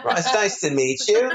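hammed-up British greeting, high and laughing; British accent. Mocking his co-star's soap role in a laughing British accent. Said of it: "And I'll be British".

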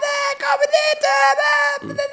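heel hoge, luide roep door het huis; luid geschreeuw. Retold or imitated. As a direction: schreeuw het hoog en hard als een druk huishouden. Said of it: "Zo echt het, het luid, luid zijn". (luid en hoog roepen)

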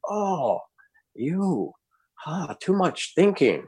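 high, slow scolding in broken English; imitating the Zen master. Zen teacher scolding student in retold interview, high mock voice.

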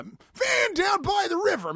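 booming motivational speaker yell; Matt Foley shout. Retold or imitated. Quoting Matt Foley character.

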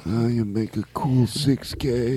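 slow, mumbled mafia don; Godfather impersonation. Pretend. Host playing a mob don questioning Blake. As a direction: drawl it like a tired old mob boss. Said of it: "Godfather impersonations".

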